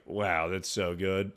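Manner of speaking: soft, low, slow dying voice; mock deathbed murmur, low and feeble; dying last words. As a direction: let it fade like a last breath. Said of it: "and then died".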